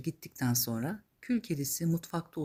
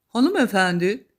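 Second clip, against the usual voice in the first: loud, raised formal call; louder, higher princely address; prince addressing a lady; 4 semitones higher, 9 dB louder than usual. Acted. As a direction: call to her as a storybook prince. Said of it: "demiş prens kül kedisine".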